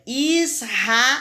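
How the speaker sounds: ISRAEL slowly by syllables, strong R. Shown as a demonstration. Teacher giving an example word, strong R in "Israel". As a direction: sound out "Israel" with the R like a double R. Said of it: "saiu com som forte".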